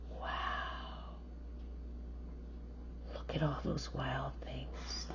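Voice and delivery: soft awed whisper. Real, not acted. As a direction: hush it like a secret. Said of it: "whispered aside to child".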